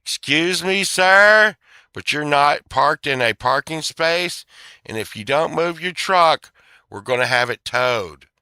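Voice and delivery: stern lot attendant threatening a tow; parking attendant. Retold or imitated. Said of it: "he's like".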